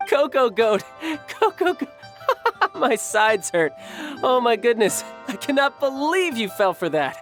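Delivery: high-pitched, breaking up with laughter; helpless laughter. Acted. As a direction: barely get it out between laughs. Laughing hard at the fooled speakers.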